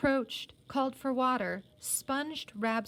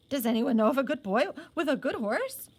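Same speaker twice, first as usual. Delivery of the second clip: lightly voiced officer; 3 semitones higher, 5 dB louder than usual. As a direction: repeat his question in a light officer voice. Narrator mimics officer's question.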